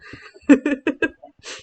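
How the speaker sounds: loud, high burst of giggles; self-amused laugh. Real, not acted. Streamer joking about being slightly smart, self-amused laugh. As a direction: laugh out loud at your own boast.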